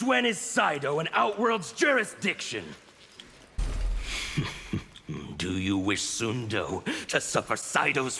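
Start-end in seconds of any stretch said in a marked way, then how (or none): 3.6-4.8 airless scornful laugh; a silent sneering laugh; breathy mocking laugh. Havoc sneering at the constable with breathy laughter.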